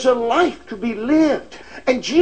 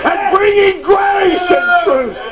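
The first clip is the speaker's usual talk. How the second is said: fiery preaching; 6 semitones higher than usual. Acted. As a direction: drive it home to the church. Preaching at full force again.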